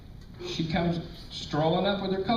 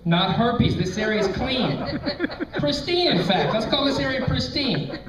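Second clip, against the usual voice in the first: flustered excuse; 7 semitones higher than usual. Retold or imitated. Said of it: "and I'm like".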